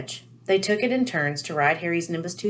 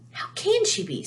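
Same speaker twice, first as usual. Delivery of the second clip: horrified; 13 semitones higher than usual. A narrator's reading. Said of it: "said Ron in horror".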